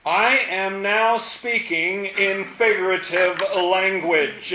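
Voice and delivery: slow emphatic quote. Shown as a demonstration. Teacher quoting Jesus, slow emphasis.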